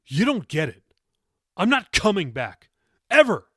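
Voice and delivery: loud, high defiant yell; angry teen yelling he is leaving for good; angry outburst. A narrator's reading. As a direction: spit each word at her. Teen storming out in anger.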